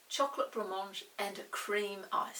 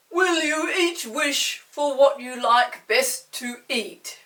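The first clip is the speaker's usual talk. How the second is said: slow, louder kindly magician; deep kind voice; 3 semitones higher, 13 dB louder, slower than usual. Acted. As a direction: ask slowly and kindly like a great enchanter. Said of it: "in his deep, kind voice".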